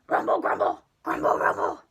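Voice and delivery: very low, loud rumbling beast voice; monster grumble. Acted. Storyteller voicing the hungry monster's deep rumble. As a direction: rumble it out like a big-bellied beast.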